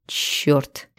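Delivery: тихое ругательство шёпотом; выдохнуть досаду про себя. A narrator's reading. (чертыхнуться шёпотом)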